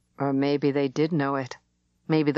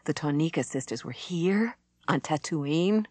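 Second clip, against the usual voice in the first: usual speaking voice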